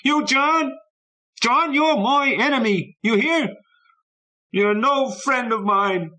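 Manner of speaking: put-on high, loud, slow furious boy's yelling; furious shout. A narrator's reading. Boy yelling at the friend who left, high furious shouting. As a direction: hurl it at the empty street, high and loud.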